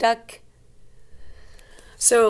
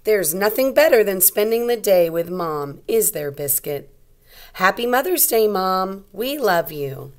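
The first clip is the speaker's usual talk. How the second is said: louder, bright storybook voice; reading to children; 6 dB louder than usual. A narrator's reading. Teacher reading a picture book aloud to young kids.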